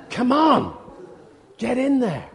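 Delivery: loud, high call to get in; hammed-up Holy Spirit yelling come on; urgent shout. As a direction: shove them forward with your voice. Spirit urging people in.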